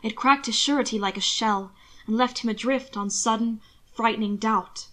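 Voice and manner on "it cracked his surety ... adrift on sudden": plain reading voice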